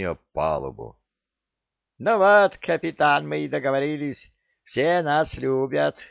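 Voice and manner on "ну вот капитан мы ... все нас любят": smug parrot. Acted. Parrot gloats to captain.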